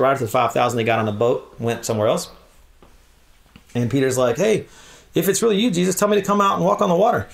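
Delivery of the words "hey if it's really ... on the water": brash Peter. Retold or imitated. Peter daring Jesus.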